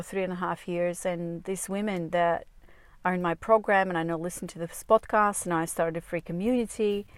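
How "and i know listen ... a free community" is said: ordinary talk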